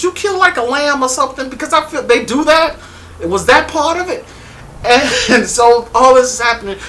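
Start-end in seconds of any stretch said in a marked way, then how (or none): 0.0-4.3 high, teasing voice; high mock-curious tease; mocking question. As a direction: poke fun at the cult story. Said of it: "quoted question".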